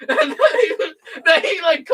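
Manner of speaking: words half-swallowed by laughter; still laughing. Real, not acted. Still telling his friend about the play, giggling through it. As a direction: keep giggling as you talk.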